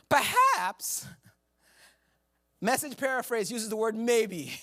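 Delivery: normal talking voice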